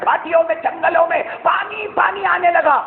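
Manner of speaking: rain pouring everywhere, voice climbing high; rising fervour. Acted. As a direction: build the joy as the rain spreads. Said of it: "بولو سبحان اللہ".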